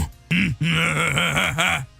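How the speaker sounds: smug staccato evil laugh; gloating cackle mid-speech; villain's chuckle. Acted. Yujiro relishes his trick, smug cackle.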